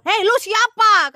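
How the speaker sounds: a stern challenge from God; voicing God. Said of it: "katanya Tuhan kan". (suara Tuhan yang tegas menantang)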